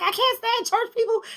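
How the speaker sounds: high-pitched exasperated venting; retold rant, voice shooting way up; going off. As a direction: vent in a high, fed-up voice. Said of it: "I'm going off".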